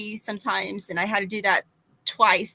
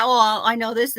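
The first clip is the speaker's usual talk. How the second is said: normal talking voice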